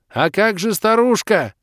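loud, high, slow shout; yelled question. Acted. Hero yelling into the old man's ear, loud and high. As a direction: shout it into his ear over the noise. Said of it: "заорал я в ухо".

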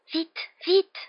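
high-pitched anxious plea; urgent moan. A narrator's reading. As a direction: moan it high, hurrying someone along. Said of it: "gémit Hermione".

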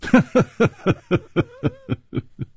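high, long chuckle trailing down; easy, unhurried laughing; leisurely laugh. A narrator's reading. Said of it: "楚留香悠然说".

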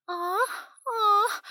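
high mock moaning; exaggerated pretend moans; fake moans. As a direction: heroine fakes moans to provoke him, high. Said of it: "изобразила стон".

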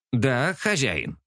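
slow, eager little creature voice; a tiny goblin-like servant answering slowly; small servant creature. A narrator's reading. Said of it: "ответил Тарк".

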